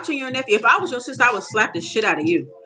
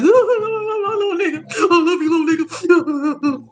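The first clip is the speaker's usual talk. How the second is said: much higher, slow fake wail; mock movie sobbing; 8 semitones higher, slower than usual.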